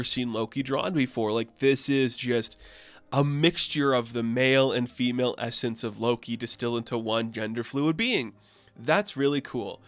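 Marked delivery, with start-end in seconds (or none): none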